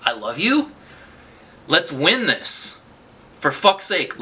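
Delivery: usual speaking voice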